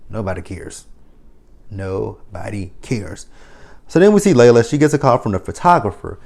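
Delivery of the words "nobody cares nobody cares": softly drawled dismissal; flat dismissive murmur. Acted. Vlogger brushing off Layla's rent worries, soft and slow. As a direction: brush her off quietly.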